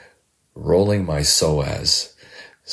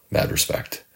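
breathy whispered echo of "mad respect"; whispered repeat; 4 dB quieter than usual. Real, not acted. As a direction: hush it like an afterthought. Repeats phrase, second time hushed.

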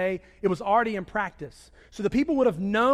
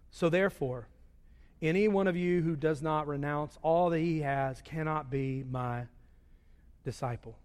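fading solemn scripture; 6 semitones lower, 6 dB quieter than usual. Acted.

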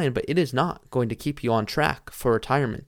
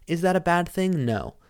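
ordinary delivery

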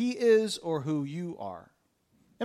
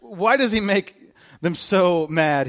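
usual speaking voice

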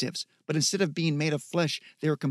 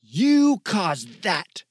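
louder, slow furious accusing; angry accusation; 4 semitones higher, 7 dB louder, much slower than usual. Acted. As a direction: hurl the blame at him. Zoe raging at Michael.

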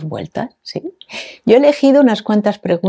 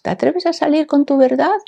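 high, lifted mock quiz question; mock test question; 9 semitones higher than usual. Host imagining the question on a test, high mock-quiz voice. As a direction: read it out like a test item, pitched well up. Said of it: "Si esto fuera un test".

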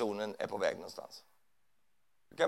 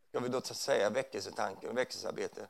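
normal talking voice

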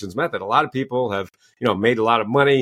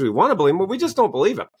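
ordinary talk

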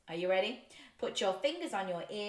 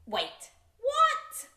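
loud, high squawk of surprise; shocked disbelief; 14 semitones higher, 5 dB louder than usual. Acted. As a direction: react as if the book tricked you. Shocked by nonsense word in book.

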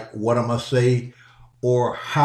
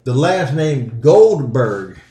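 usual speaking voice

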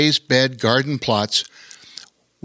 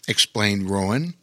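usual speaking voice

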